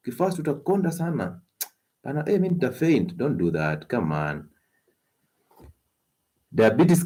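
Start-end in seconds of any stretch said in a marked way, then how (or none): 0.1-3.2 high, whiny mimicry; mock fearful excuse. Doctor imitating listeners' excuses, high mocking whine. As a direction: parody a worried dieter, pitched up. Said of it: "That's what you tell us".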